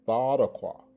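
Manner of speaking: Thadakwa with a TH, loud; alternative pronunciation. Shown as a demonstration. Teacher gives the alternative pronunciation, loud demo of Thadakwa with TH. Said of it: "the alternative pronunciation is".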